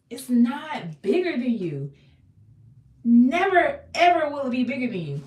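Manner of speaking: fired-up sermon climax; rising preacher shout. Real, not acted. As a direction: preach it, peaking on never.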